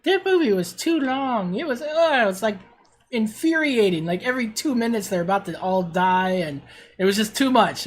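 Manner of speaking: high, whiny mock teen voice, getting loud by the end; teen daughter. Dad imitating his teen daughter's movie complaint, high and whiny. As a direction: mimic a bored teenager complaining. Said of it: "and she was like".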